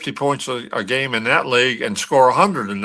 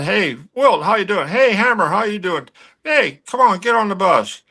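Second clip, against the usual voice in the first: calling out; 7 semitones higher than usual. Retold or imitated. Hank calling Wilt from bus.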